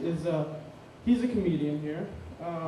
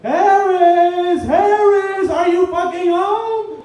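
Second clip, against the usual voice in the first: high-pitched furious bellow, imitating the girlfriend; enraged yell; 13 semitones higher, 13 dB louder, much slower than usual. Girlfriend hunting the messy roommate upstairs, enraged yelling. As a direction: holler up the stairs, each word stretched out. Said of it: "is enraged, like Dante's Inferno has been lit inside her".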